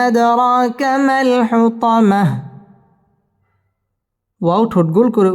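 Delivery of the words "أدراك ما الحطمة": slow, sung tajweed chant; Quran recitation. Shown as a demonstration. Teacher reciting the surah for students to follow, chanted. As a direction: let each line ring out melodically.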